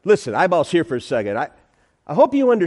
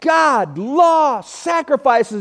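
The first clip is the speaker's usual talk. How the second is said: higher, insistent no, no; mock stubborn refusal; 8 semitones higher, 3 dB louder, much slower than usual. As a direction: dig in and refuse. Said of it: "Saul was like".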